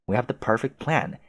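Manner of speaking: normal talking voice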